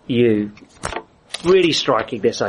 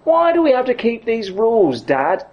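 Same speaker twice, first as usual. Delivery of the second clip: put-on whiny kid; 8 semitones higher than usual. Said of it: "The kid says".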